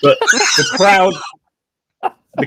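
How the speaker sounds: laughing through the words; words breaking into laughter. Real, not acted. GM laughing mid-sentence.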